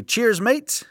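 high, bouncy British "Cheers, mates"; British accent. Retold or imitated. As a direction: toss off "Cheers, mates" like a Londoner. Quotes the British listener's sign-off.

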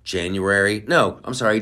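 ordinary talk, nothing marked

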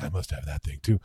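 very low mock-solemn voice; exaggerated deep "must have it" voice; put-on wanting voice. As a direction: intone it deep, like someone helplessly compelled. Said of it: "I'll say it in that voice".

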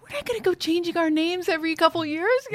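high laughing quote. Retold or imitated. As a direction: laugh through the woman's reply. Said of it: "She's like".

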